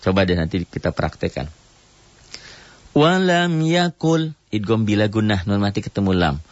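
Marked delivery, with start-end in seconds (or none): 2.9-4.3 slow, high melodic tartil chant; Quran recitation. Shown as a demonstration. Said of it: "teacher recites Quran verse". (lantunan tartil pelan)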